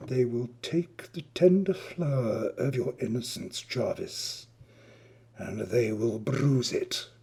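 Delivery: hushed insinuation. Acted. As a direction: lean in and murmur it. Adrian whispers seductive threat.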